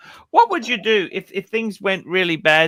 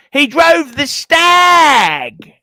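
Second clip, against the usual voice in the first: excited; 9 semitones higher, 8 dB louder, much slower than usual. Real, not acted. Excited recall, loud.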